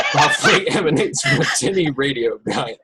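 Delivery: giggling through the scene description; laughing through narration. Real, not acted. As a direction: try to read on but crack up. GM cracks up mid-narration.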